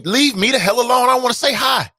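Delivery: exasperated outburst; frustrated shout. Real, not acted. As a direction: let it burst out in frustration. Fed-up rant about greetings.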